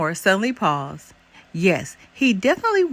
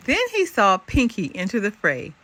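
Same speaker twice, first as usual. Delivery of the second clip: ordinary delivery, nothing marked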